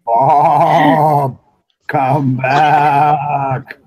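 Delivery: long drawn-out loud call. Pretend. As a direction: call Bob back, stretching each word. Calling out to unresponsive Bob.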